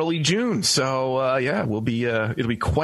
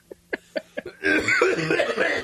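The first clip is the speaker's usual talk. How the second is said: high bursting laugh; burst of laughter; 13 semitones higher than usual. Real, not acted. Host cracking up at his co-host's story, high laugh.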